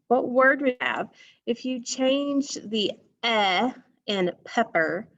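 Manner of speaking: ordinary talk, nothing marked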